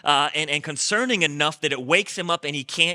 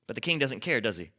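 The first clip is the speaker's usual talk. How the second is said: ordinary talk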